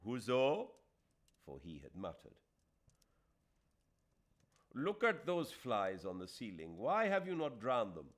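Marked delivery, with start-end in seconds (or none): 0.0-0.7 louder, higher call of the name. A narrator's reading. Aziz calling his servant into the room. 4.7-8.1 higher, louder scolding of the servant; irritable master. Acted. Aziz scolding his servant about the ceiling flies.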